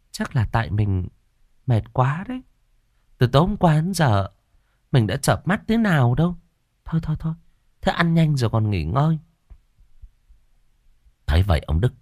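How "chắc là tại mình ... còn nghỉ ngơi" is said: high, soothing wifely voice; reassuring wife. A narrator's reading. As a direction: coax a dazed husband gently in a raised pitch. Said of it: "Bà Hà thấy chồng bần thần thì động viên". (giọng vợ động viên chồng, cao và dịu)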